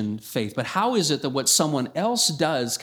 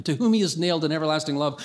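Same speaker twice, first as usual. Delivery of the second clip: ordinary talk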